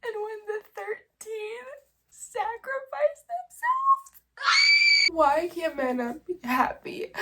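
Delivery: wailing in grief, holding back; crying wail. Real, not acted. At one a.m., scream-crying about the characters. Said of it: "not scream cry right now".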